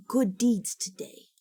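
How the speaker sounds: slow, drawn-out menacing whisper. Pretend. Telling viewers she's done with good deeds, a slow menacing whisper. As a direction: hiss the end of the threat.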